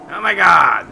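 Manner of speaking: startled, loud high exclamation; loud outburst. Real, not acted. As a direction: blurt it out too loud. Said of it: "Sorry, I'm being loud again".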